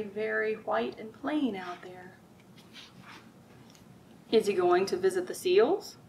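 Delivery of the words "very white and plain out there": whisper. Acted. Reading picture book to children.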